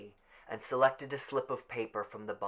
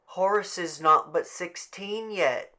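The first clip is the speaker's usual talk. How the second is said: regretful; 8 semitones higher, 7 dB louder than usual. Acted. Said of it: "Missus Dunbar said regretfully".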